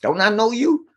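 lightly voiced cop. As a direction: imitate a cop recognizing someone. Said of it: "The cop comes up to me".

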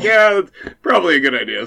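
high, loud laughing reply. Real, not acted. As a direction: laugh through a sheepish answer. Laughing retelling own reply.

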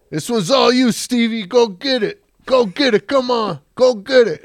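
put-on loud high goading, salesmen pushing the new kid; egging him on. Salesmen loudly goading the new kid toward a customer, pushy and high. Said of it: "and they go".

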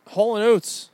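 big announcer name call. Acted. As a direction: boom it like an announcer.

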